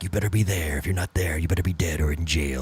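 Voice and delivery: deep, soft radio promo character. Acted. Host recreating his radio show promo, deep ominous delivery. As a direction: sink low and warn them to show up. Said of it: "I did it for our first Stokeswood show promo".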